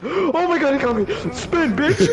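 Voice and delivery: panicking gamer. Real, not acted. Caught by killer.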